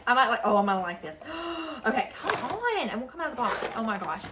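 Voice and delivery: usual speaking voice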